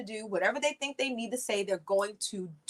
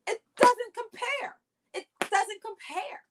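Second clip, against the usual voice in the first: exasperated; 11 semitones higher, slower than usual. Real, not acted. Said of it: "repeated exclamation".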